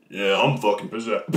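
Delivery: low, put-on possessed voice; creepy voice. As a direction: drop way down into a mock-creepy voice. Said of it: "let me try my creepy voice".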